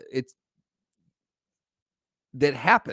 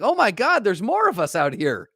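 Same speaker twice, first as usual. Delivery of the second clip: much higher and louder amazement; mock amazed toddler; 6 semitones higher, 4 dB louder than usual. Toddler amazed to see other people. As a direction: gasp like a kid discovering people. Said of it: "it's like".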